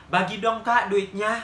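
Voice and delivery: mock angry beggar demanding money. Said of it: "ekspresi dia kayak marah".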